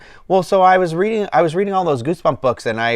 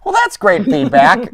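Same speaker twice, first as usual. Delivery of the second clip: sarcastic; 7 semitones higher, 6 dB louder, slower than usual. Retold or imitated. Retold dry reply to friend.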